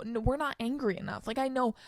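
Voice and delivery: ordinary talk, nothing marked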